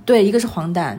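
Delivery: ordinary delivery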